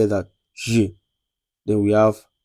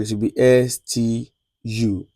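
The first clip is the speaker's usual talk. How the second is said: normal talking voice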